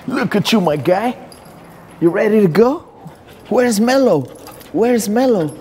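high sing-song voice for the dog; pet talk. Real, not acted. Cooing at the dog.

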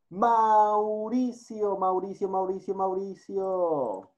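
teacher calling a silent student. Real, not acted.

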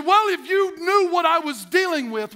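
mock blow-up at the family; defensive outburst. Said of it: "And all of a sudden you say".

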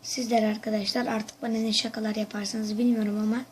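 ordinary talk, nothing marked

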